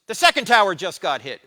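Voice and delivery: pastor blurts it out loud; alarmed news. Retold or imitated. Pastor on the phone announcing the second hit, alarmed and loud.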